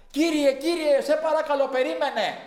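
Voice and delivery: loud, high call from a distance. Retold or imitated. Young man calling after the preacher from far off. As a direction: call after him pleading to wait.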